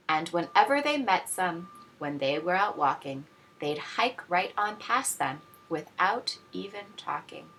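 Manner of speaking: usual speaking voice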